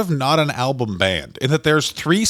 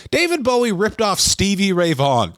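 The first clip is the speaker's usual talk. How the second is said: ordinary talk, nothing marked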